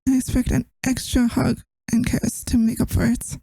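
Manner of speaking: ordinary delivery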